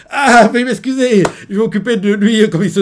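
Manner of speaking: bow and scrape before the superior; embarrassed officer apology. Retold or imitated.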